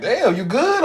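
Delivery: mock concern tossed at someone; surprised check-in.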